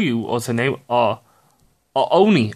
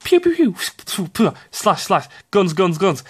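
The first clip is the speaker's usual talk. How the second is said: gunfire noises. Retold or imitated. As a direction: imitate blasting guns. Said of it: "what she does?".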